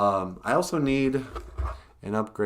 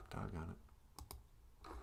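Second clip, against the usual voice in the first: whispered; 2 semitones lower, 21 dB quieter, much slower than usual. Real, not acted. Muttered frustration.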